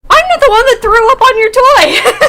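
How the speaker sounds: high, loud sibling retort; mock indignant. Sister accused over the toy, mock indignant. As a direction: protest loudly, on the edge of laughter.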